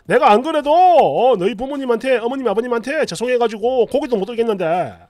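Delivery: a husband yelling at his crying wife; barking husband. Retold or imitated. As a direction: shout her down for crying. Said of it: "여전히 짖고 있습니다".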